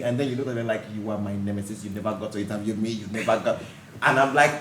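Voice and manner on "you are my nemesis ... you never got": lower, softer teasing accusation; playful nemesis line said low and soft; low mock accusation. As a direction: drop the voice low to tease. Quoting host's jab at her.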